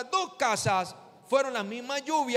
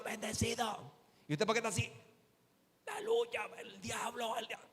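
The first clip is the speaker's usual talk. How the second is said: mock strained, voiceless croak, much softer; voice gone; 8 dB quieter than usual. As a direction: act out having lost your voice from shouting. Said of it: "te dejó sin voz".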